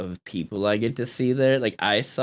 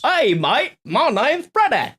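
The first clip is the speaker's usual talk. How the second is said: high, loud, laughing fake Australian accent; hammed-up Australian accent while laughing; bad Australian accent; 14 semitones higher, 5 dB louder, slower than usual. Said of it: "I just butchered that so bad".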